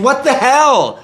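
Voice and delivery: high, incredulous exclamation; hammed-up high-pitched shock; mock outrage. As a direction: act out a dismayed crowd crying out. Acting crowd shocked by bear market.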